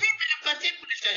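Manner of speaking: put-on fast, high objecting old woman; quick high old woman objection; hurried old lady. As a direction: rush the objection like her.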